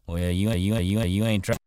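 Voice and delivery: loud, teasing ranch hand; cowboy voice. A narrator's reading. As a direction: tease her with easy confidence. Slim voiced again.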